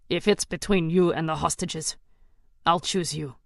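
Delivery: steady, devoted promise; a hint of a gruff comrade; loyal soldier's reply. Soldier answers over the comms.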